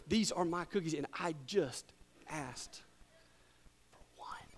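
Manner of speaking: fading from soft to a bare whisper; dropping to whisper. Acted. Preacher ends cookie story hushed, fading to whisper. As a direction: sink the voice to nothing.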